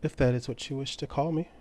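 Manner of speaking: high and soft, an ancient being; mysterious entity. Acted. Ancient entity answers Beatrix softly in her mind. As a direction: speak softly, like something old and unseen.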